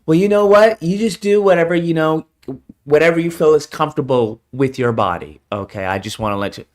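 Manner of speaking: lightly voiced pushover; mock permissive man. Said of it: "What do they find?".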